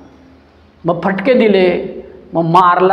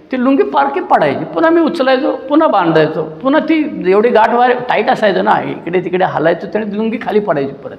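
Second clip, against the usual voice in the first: usual speaking voice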